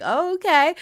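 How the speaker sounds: high, bright mock-startled okay; a surprised oh, okay; mock surprise. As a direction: act caught off guard, then shrug. Reacting to flirting gamer.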